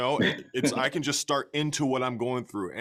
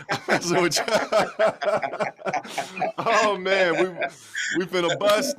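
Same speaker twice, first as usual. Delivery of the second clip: louder, higher, laughing through the words; laughing at own joke; 7 semitones higher, 7 dB louder than usual. Real, not acted. Friend laughing after teasing about the hat, loud laughing. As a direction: crack up at your own teasing. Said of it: "I'm kidding".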